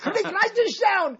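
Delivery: high, loud, laughing "write this down"; excited call. Real, not acted. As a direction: shout it out with glee. Excitedly calls to cohost.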